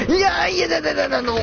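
high-pitched panicked stream of refusals; startled panic. Real, not acted. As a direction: yelp in alarm, pitch shooting up. Startled by threat in horror game.

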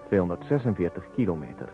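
normal talking voice

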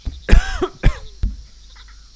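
loud choking cough; coughing fit. Real, not acted.

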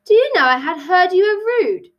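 high, mildly indignant snap; snapping. A narrator's reading. Tilly answers the rude Hare, snapping. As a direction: snap back, offended. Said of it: "Tilly snapped".

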